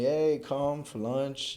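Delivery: retold motherly "come on over" call; mom calling. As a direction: call out slowly, as a mom inviting her son to lunch. Mom's call inviting him to lunch.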